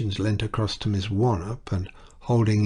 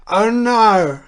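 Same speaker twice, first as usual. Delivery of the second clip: loud, high cry of alarm; scream; 12 semitones higher, 9 dB louder than usual. A narrator's reading. Wife screams to stop her husband's speech. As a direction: scream to cut him off. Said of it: "Missus Duchemin suddenly screamed".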